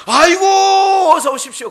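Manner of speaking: high, loud, fawning "come in"; overjoyed host bowing to a nobleman; eager welcome. Acted. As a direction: gush out a welcome. Rich host greets nobleman eagerly.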